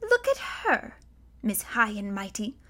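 mocking ghostly female voice sneering at a girl; derisive. A narrator's reading. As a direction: sneer it with scorn. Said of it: "A familiar female voice said with derision".

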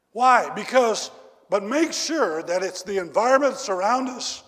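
loud, high preaching burst; preaching shout. Real, not acted. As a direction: let it ring out over the crowd. Preaching climax outburst.

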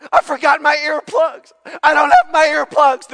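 high, loud hammed-up lament; mock panicking mother-in-law. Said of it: "why is she panicking".

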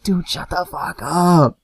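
mock yell with the volume held back; retold yelling. Host scolding noisy friends while parents sleep, mock yell. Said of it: "So I'm yelling at them".

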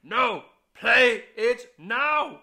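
loud slow high command; shouting. Pretend. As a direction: bark it out in fun.

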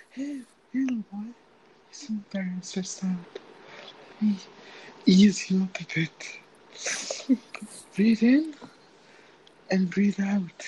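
slow, soft parody of a sleep ASMR; mock ASMR girl. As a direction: imitate the creepy ASMR girl soothing you. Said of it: "and it goes like".

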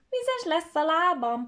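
high, loud, slow whine; whiny complaint. Acted. Gingerbread boy riding the fox, high slow whining. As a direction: complain about wet feet. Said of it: "panaszkodott a mézes kalács fiú".

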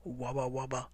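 low, soft moaning wabba; ghostly moan. Acted. Penguins haunting hunters, soft low moan. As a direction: moan like a dark spirit. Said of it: "moaned the dark one".

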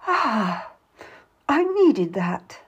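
slow, sighing relief; tearful. A narrator's reading. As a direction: let it out with a touch of tears. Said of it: "she says tearfully".

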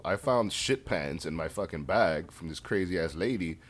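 ordinary delivery

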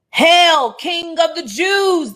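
loud, high, drawn-out mock salute; mocking hail. Soldiers jeering at Jesus in loud high mock praise. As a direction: jeer it like a sneering soldier. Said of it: "they mocked".

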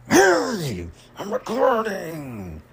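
drawn-out, high-pitched yell at someone off-mic; real irritated shout, dragging the words out; angry yell. Real, not acted. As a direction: holler it high across the house, exasperated. Interrupted, yelling to someone at home.

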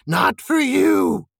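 high, loud, drawn-out creature cry; Gollum crying out. A narrator's reading. Creature defying the east, slow high cry. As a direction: stretch it into a defiant wail. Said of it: "he cried".

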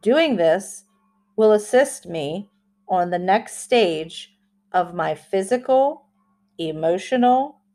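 slow, deliberate affirmation reading. Shown as a demonstration. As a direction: read each phrase slowly with pauses. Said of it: "I will say it slowly like I always do".

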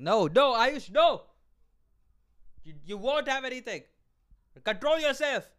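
loud, high self-scolding to Ayush; shouting at himself. Shown as a demonstration. Man yelling at himself to resist fries, loud. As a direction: bark orders at your own appetite. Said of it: "I just shout at myself extremely hard".

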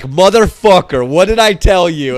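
loud imitation of a scolding; retold angry confrontation. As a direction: lay into him, imitating an angry friend. Retold Matt confronting him.

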